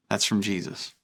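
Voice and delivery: normal talking voice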